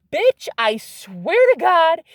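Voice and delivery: high, loud, slow-stretched disbelief; emphatic amazed outburst; astonished insistence. Real, not acted. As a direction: swear it's true, utterly amazed. Swearing the card really came out.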